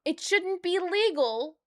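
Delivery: normal talking voice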